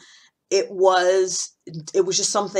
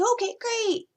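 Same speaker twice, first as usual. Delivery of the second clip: very high, sing-song agreement; imitating too-nice friends; slower than usual. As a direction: squeal a mock-supportive reply. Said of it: "And they say".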